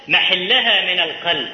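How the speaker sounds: ordinary talk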